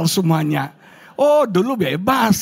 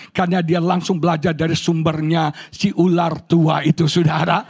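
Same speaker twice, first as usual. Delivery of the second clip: usual speaking voice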